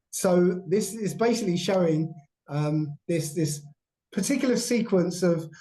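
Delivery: ordinary talk, nothing marked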